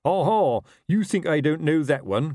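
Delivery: mild suspicious shout. A narrator's reading. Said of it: "Colon shouted".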